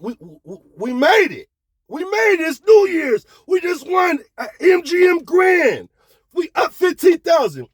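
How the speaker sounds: very high, loud victory cry; jubilant shouting. Retold or imitated. Friends celebrating their New Year's win, high cries. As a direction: scream it like you just hit big.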